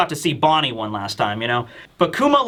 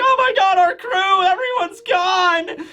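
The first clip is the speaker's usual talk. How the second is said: much higher, frantic wailing shout; panicked crew. Retold or imitated. Straw Hats separated not knowing what happened, panicked wail. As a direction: imitate the crew freaking out. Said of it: "they were obviously all upset".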